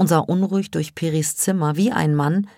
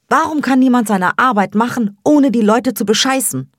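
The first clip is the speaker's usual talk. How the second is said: higher and louder furious rant; angry outburst; 8 semitones higher, 6 dB louder than usual. Acted. Sevda rants to her sister about the cheating taxi driver, furious. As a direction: let the frustration explode.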